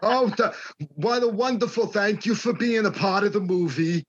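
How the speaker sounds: put-on Brooklyn Gary Marshall voice; Gary Marshall accent. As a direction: do Gary Marshall thanking everyone. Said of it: "that Gary Marshall fucking accent".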